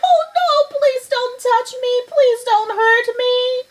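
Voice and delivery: very high, panicky begging; whimpering. Acted. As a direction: beg like a terrified lion. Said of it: "he whimpered".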